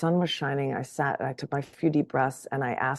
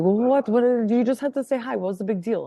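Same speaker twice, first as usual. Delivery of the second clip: mother defending herself; 6 semitones higher, 3 dB louder than usual. Retold or imitated. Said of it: "And she's like".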